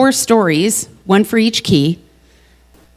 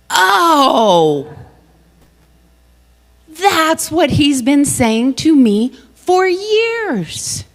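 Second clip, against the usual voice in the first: higher, slow, awestruck realization; dawning realization; 6 semitones higher, much slower than usual. Retold or imitated. Suddenly understanding her partner, slow and amazed. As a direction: let it land slowly, amazed.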